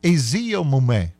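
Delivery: slow Aziyomume; practising Aziyomume; practice. Shown as a demonstration. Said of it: "now it's starting to get it".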